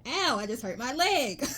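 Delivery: normal talking voice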